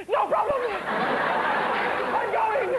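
high, hammed-up eager reply; eager child superhero. Child superhero to mother sending them to shops, hammed-up eagerness. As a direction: pipe up high, playing a keen little hero. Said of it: "and you go".

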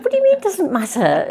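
recalling pushing back on her boss, indignant; indignant disbelief. Retold or imitated. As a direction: flare up in disbelief at his shrug. Retold protest to a colleague.

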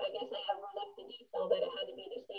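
tinny, distant bad-mic voice; like talking through a tin can; tin can sound. Real, not acted. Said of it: "sound like you're in a tin can".

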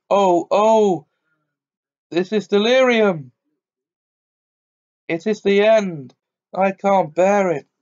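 high, loud whining lament; wailing. Acted. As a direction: wail like a self-pitying old man. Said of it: "It was wailing on".

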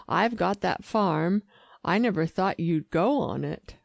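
ordinary delivery

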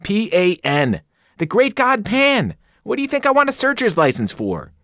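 offended satyr insisting; indignant outburst. Acted. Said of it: "he cried indignantly".

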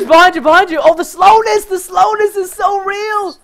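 very high, loud wail; exasperated battle shouting. Real, not acted. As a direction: yell a warning, then wail in frustration. Warning and wailing over slowness.

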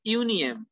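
UNIUM said "yoo-nyem"; French pronunciation. Shown as a demonstration. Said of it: "for one we say Unium".

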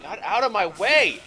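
loud, high yell; angry shout. Real, not acted. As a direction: bark them aside. Pushing through enemies.

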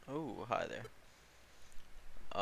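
mid-yawn, slow and soft; words dragged through a yawn. Real, not acted. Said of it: "I'm just yawning a bunch right now".

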